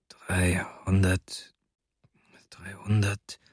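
slow whispered counting to oneself; hushed, drawn-out murmur of figures; whispering. A narrator's reading. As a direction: whisper it slowly, as if calculating privately. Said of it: "flüsterte er".

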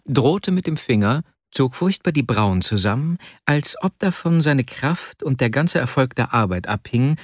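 ordinary delivery

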